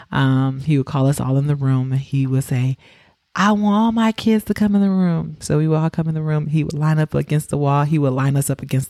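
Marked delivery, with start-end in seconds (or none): 3.3-5.4 lightly voiced dad, pitched higher; imitating dad calling. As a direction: call the kids in like a fond dad.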